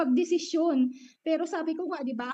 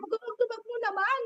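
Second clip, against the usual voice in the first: imitating colleagues; 9 semitones higher than usual. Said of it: "ganun yung sinasabi ng mga kasama ko".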